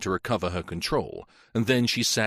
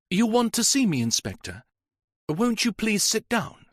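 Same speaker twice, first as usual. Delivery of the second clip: put-on a higher, careful young woman's voice; Janet, grave young woman; 12 semitones higher than usual. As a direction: pale and tired, politely offering a seat. Young woman Janet greets inspector.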